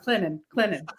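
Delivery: Clinton said Clin-nen with no T; local pronunciation. Shown as a demonstration. Guest demonstrating hometown Clinton, no T. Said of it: "that's how it was said growing up".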